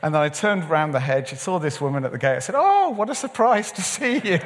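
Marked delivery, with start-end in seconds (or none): 2.6-4.4 high, over-delighted greeting; hammed-up surprise, much higher; feigned surprise. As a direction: pretend it's a happy accident, pitched way up. Feigns surprise at planned meeting.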